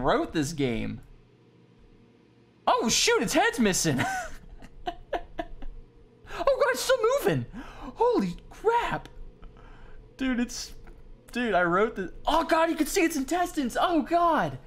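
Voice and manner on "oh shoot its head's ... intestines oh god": squealing high and fast in disgust; panicked squeaky gasping; high-pitched freakout. Real, not acted. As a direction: shriek at the gore, really grossed out. Gross-out at a mangled rat.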